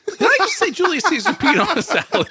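giggling through the question; laughing at his own slip. Real, not acted.

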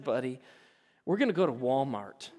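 calm a little son down gently; gentle dad voice. Retold or imitated. Father calming upset son.

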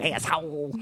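put-on a light Jersey accent on asshole; mock Jersey asshole. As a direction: copy the way she says Oh, asshole. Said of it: "you'll try to imitate".

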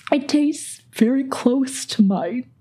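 a light impression of her mother, slowed down; mom's voice. Daughter voicing mom's comment.

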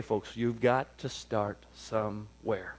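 slow preacher stress on got; slow emphasis. Shown as a demonstration. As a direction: stretch it out slowly. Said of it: "let me say it slow".

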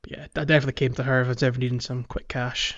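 normal talking voice

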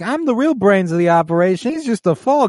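high, put-on mobster voice; courtroom witness. Mob witness boasting in the courtroom, high acted voice. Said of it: "where he's like".

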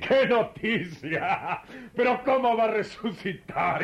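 slow, laughing sarcasm; laughing mockery. Scoffing at the Christians' news, laughing slowly. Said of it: "vos lo tomás a risa".

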